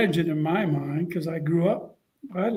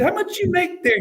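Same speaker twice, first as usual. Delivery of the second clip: much higher coach question; Spurrier voice; 12 semitones higher than usual. Retold or imitated. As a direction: ask it pitched way up. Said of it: "He said".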